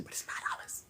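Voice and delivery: very soft secret whisper. Pretend. Afraid of a violation, whispering the price to the ladies. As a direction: drop to a whisper so nobody catches it. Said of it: "'cause I'm not supposed to say stuff on here".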